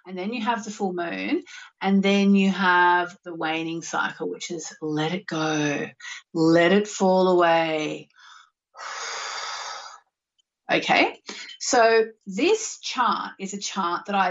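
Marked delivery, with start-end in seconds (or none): 4.8-8.1 slow, drawn-out release; letting go. Shown as a demonstration. Showing listeners the waning cycle, slow letting go. 8.8-9.9 long audible exhale; releasing sigh. Shown as a demonstration. Showing listeners how to let go, long sigh.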